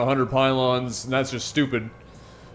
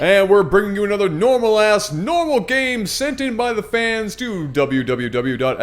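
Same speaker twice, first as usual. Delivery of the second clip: announcer intro; 5 semitones higher, 3 dB louder than usual. Acted.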